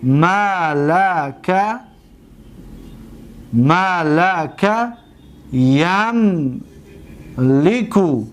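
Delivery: very slow, syllable by syllable; ملکه drawn out slowly, ma-la-ka; slow demonstration. Shown as a demonstration. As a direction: stretch ملکه out so the vowels are clear. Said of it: "دقت بتید،".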